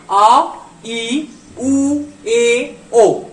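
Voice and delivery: आ, ई, उ, ए, ओ each said clearly and apart; careful vowel demo. Shown as a demonstration. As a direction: spell out आ ई उ ए ओ slowly for learners. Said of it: "इसके तरफ थोड़ा सा ध्यान दीजिएगा".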